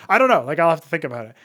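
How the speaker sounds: ordinary delivery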